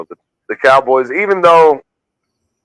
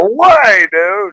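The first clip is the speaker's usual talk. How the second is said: high, drawn-out cry of disbelief; shocked disbelief; 8 semitones higher, much slower than usual. Real, not acted. Co-host hearing of a second crash, stunned. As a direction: burst out in astonishment.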